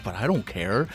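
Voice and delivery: quiet, low brush-off; dismissive mutter. Real, not acted. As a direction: shrug it off quietly. Dismissive repeated refrain.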